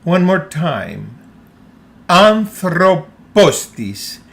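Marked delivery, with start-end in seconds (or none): 2.1-4.2 "Anthropos tis" slowly as one word, accents on first and last syllables of Anthropos; two accents, one word. Shown as a demonstration. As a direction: stress both AN and POS in "Anthropos tis", slow and loud, tis unstressed. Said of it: "It's pronounced like one word".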